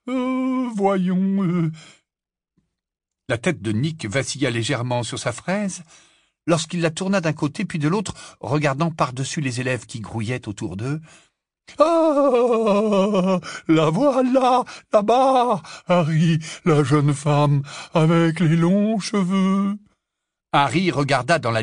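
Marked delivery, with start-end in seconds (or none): 0.1-1.7 put-on high, wavering umm of an old ghost; stall, thinking aloud; hesitant ghost. A narrator's reading. Nick the ghost searching the crowd, high and hesitant. 11.8-19.8 high, loud ghostly calling out; excited ghost. A narrator's reading. Nick pointing Harry to the Grey Lady, high and loud.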